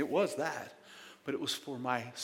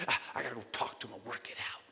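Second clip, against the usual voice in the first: mumbled excuse; 2 semitones lower, 5 dB quieter, much faster than usual. Retold or imitated. Pastor mimics what everybody says, quick under-the-breath mumble. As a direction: rattle it off under your breath like a stock excuse. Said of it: "That's the first thing everybody says".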